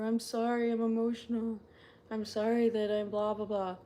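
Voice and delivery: high, loud pleading sorry; mock emotional apology. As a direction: pitch up the apology as an exaggerated imitation. Continues acted needless apology.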